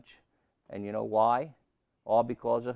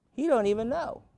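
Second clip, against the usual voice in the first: much higher, louder exclamation; emphatic high; 13 semitones higher, 4 dB louder than usual. Acted. Preacher telling the church Steve doesn't know, in a high exclamation.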